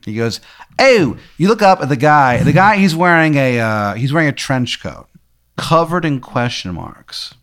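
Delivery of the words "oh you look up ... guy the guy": put-on high, loud Maryland-accented "oh"; hammed-up Maryland vowel, pitched way up; bad Maryland twang; terrible Maryland accent. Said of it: "he's got, like, a terrible Maryland accent".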